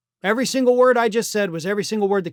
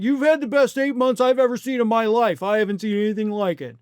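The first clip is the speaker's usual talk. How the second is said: a mocking impression of a fawning interviewer; mock sycophant; 2 semitones higher than usual. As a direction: fawn over him like a flatterer. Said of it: "be like".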